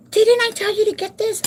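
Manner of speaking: shrill-free mock yell of a grouchy elderly Jamaican lady; grouchy old lady yelling. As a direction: imitate her high-pitched yelling. Old lady yelling, retold quarrel.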